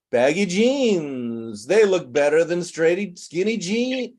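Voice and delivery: mimicking a sing-song, "jeans" drawn out in a long rise and fall; exaggerated sing-song mimicry; imitated sing-song tone. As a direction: stretch "Baggy jeans" into a swooping tune, lifting on "skinny jeans". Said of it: "that's what I call a sing-song tone".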